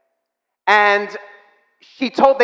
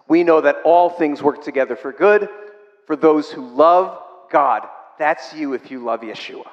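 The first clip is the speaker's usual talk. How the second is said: normal talking voice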